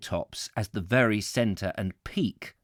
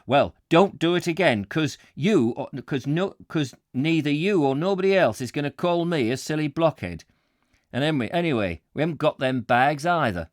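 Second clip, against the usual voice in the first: higher, forceful Yorkshire warning; aggressive Yorkshire voice; 4 semitones higher, 3 dB louder than usual. Acted. Warehouseman tells off his boss, aggressive Yorkshire voice. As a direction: warn him off in broad Yorkshire. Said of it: "this aggressive Yorkshire voice".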